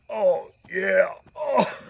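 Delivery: loud, hammed-up groan; loud clowning. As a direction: show off shamelessly at full volume. Parents clowning around the store.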